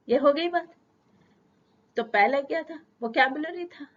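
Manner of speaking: ordinary talk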